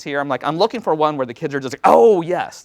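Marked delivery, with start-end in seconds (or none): 1.8-2.6 louder, higher mock-eager kid reaction; acted-out burst of enthusiasm; eager approval. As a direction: imitate kids suddenly keen on it. Kids' keen reaction, acted out.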